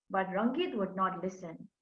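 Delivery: ordinary delivery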